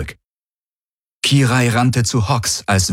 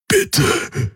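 strangled, higher-pitched plea; choked voice; 7 semitones higher than usual. A narrator's reading. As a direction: squeeze the word out through a choked throat. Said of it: "sagte Hox mit erstickter Stimme".

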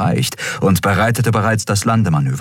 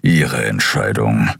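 very low, slow grumble; 9 semitones lower, slower than usual. A narrator's reading. Keru accepts Kai's refusal, grumble. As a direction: rumble it, dismissively slow. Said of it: "brummte Keru".

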